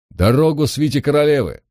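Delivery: calling out. Acted. Servant calling to clear the queen's retinue's way, calling out. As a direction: call out to clear a path.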